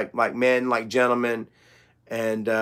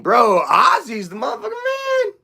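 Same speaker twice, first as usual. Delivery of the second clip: high, swooping shout of admiration; real fired-up enthusiasm; excited hype; 9 semitones higher than usual. Real, not acted. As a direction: burst out like a huge fan. Fan hyping up [name].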